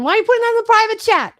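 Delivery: high, loud, fast mock scolding; mock outrage. Host teases Heather for hiding praise, mock outrage. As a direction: burst out in playful indignation.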